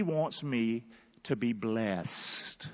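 quiet preacher's emphasis; hushed. Real, not acted. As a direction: drop to a soft, slow hush on "to be blessed". Preacher drops voice on blessing.

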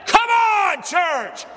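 high, loud rallying call; preacher stirring up the crowd; shouting to the church. Real, not acted. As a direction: rouse the congregation.

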